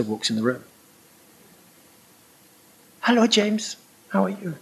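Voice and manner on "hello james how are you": small, high, soft voice; gentle imitation of a tiny nun, hushed and high; Mother Teresa. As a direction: greet softly in a high-pitched voice. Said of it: "In walks Mother Teresa".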